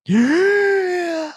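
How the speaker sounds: big gasp of air before a long list; deep gasp. Real, not acted. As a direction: gulp in a huge breath. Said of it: "in one breath".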